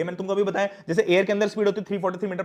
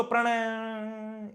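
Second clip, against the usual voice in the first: "Pranay" with a long sustained tail; reverberation; 3 semitones higher, 4 dB quieter, much slower than usual. Shown as a demonstration. Teacher showing big-hall reverberation, drawn-out ringing ending. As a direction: hold the last syllable on and on. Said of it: "इसको मैं reverberation बोलता हूं".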